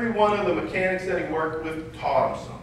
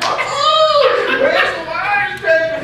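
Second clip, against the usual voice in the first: high, loud mocking singsong; mocking tease; 13 semitones higher, 8 dB louder, much slower than usual. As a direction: taunt the new guy like a schoolyard bully. Seniors mocking him, laughing.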